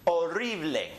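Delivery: horrible as o-RREE-bleh, silent H; Spanish horrible, stress on the RRI; Spanish pronunciation. Shown as a demonstration. Teacher contrasts it with English, horrible as o-RREE-bleh. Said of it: "Read that with me".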